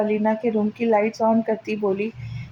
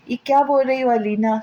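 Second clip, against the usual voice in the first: plain reading voice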